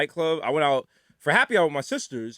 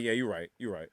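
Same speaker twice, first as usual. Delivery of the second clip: low, soft concession; sheepish backing down; 8 semitones lower, 10 dB quieter than usual. Retold or imitated. Customer to security after doing the math, conceding. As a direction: give in under the breath.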